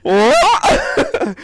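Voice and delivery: high-pitched, loud replay of shock; mock-astonished high yelp; shocked reaction. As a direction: imitate your stunned reaction, amused. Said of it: "I was like".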